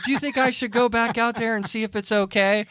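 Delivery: high, plaintive imitation of the wife; mock-tearful plea; near tears. Wife wanting to check on the bird, mock near-tears. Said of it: "almost to the point of tears".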